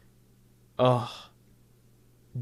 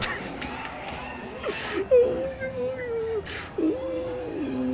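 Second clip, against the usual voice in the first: high, strained cringing laugh; squealing laugh of pure cringe; 3 dB quieter than usual. Real, not acted. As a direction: laugh in pained embarrassment. Cringing at own video.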